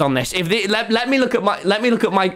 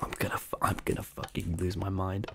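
hushed, breathy despair under the breath; barely voiced muttering of frustration, much softer; whispered curse of frustration; exasperated whisper; 12 semitones lower, 15 dB quieter than usual. Real, not acted. Frustrated gamer muttering at himself.

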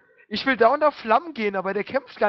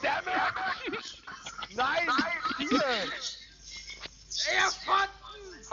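frantic yelling; 11 semitones higher, 8 dB quieter, much slower than usual. Real, not acted. Losing fight, yelling at screen.

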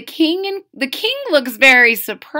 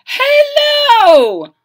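much higher, loud, stretched hello; shrieked king hello; 14 semitones higher, 11 dB louder than usual. Acted. King greeting a crowd of monkeys, squealing loud.

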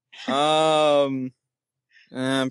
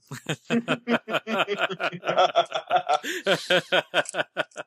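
long, higher-pitched giggling fit; long laugh; 5 semitones higher than usual. Real, not acted. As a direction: laugh on and on in bursts. Amused at the character's claim.

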